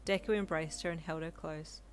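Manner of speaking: ordinary delivery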